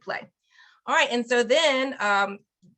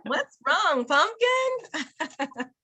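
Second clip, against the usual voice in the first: rising singsong; 6 semitones higher, much slower than usual. Shown as a demonstration. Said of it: "student translates".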